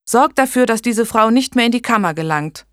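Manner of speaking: high, loud, firm command; urgent order. A narrator's reading. Blanche telling the boy to bar the midwife, commanding. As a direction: give a forceful instruction.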